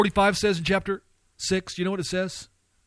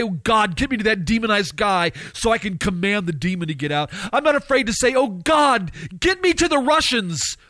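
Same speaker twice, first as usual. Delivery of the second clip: fervent prayer building to high-pitched pleas; impassioned preacher's plea; emotional begging; 5 semitones higher than usual. Acted. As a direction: beg God with open emotion, climbing in pitch. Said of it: "not afraid to have emotion and say".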